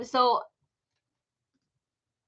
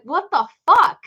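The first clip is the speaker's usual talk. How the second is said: louder, indignant outburst; a retold mad "what the fuck"; angry disbelief; 6 semitones higher, 8 dB louder than usual. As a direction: snap it at the gunman in disbelief. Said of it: "I got mad".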